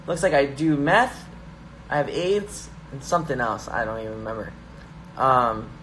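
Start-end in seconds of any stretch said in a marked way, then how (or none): none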